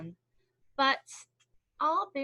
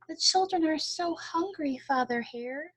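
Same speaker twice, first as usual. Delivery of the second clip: mother pleading; 6 semitones higher than usual. A narrator's reading. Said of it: "Missus Hare cried one day".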